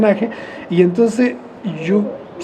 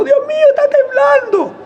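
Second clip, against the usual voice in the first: much higher, squealing mock panic of young shop girls; panicky shop girls. Shop girls at the cheese counter cry out during the earthquake, in a much higher squealing mock panic. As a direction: imitate the girls shrieking about the quake. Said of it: "decía".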